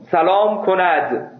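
very slow, fully pronounced "سلام کند"; "سلام کند" in careful bookish diction; formal written Persian. Shown as a demonstration. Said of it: "مثل متن نوشتاری".

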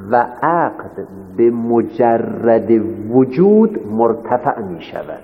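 formal, drawn-out statement of a premise; slow dictation. Real, not acted. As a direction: dictate slowly, holding the words before the final fall. Teacher dictates the major premise. (شمرده و رسمی، مثل دیکته گفتن)

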